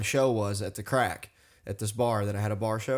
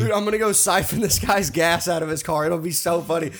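mock prankster; 5 semitones higher, 4 dB louder than usual. Mocking a dumb prankster.

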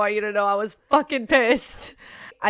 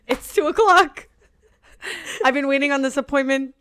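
half-cry, half-laugh at the memory; waking up late, laughing despair; laughing panic; 3 semitones higher than usual. Retold or imitated.

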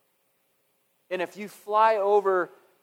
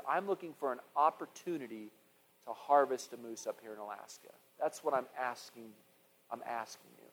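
soft, reverent, much quieter; hushed prayer; 5 semitones lower, 9 dB quieter than usual. Retold or imitated. Hunter retelling his quiet prayer to the Lord, soft and reverent. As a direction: pray it low, almost to yourself.

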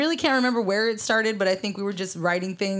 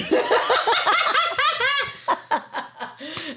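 high squealing laugh. Real, not acted. Big laugh.

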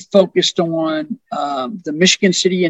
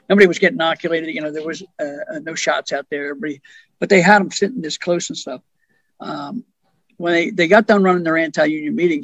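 ordinary talk, nothing marked

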